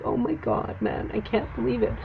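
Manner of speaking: breathless, choked-up whisper, pitched high; overwhelmed whisper on the edge of tears; tearful whisper. Retold or imitated. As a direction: gasp it out as if about to cry. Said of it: "I started to cry".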